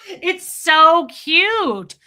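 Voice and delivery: high, loud, drawn-out gush; gushing squeal. Real, not acted. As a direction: squeal it with delight, stretching the words. Gushing over her partner.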